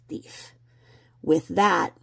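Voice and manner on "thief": whispered. Real, not acted.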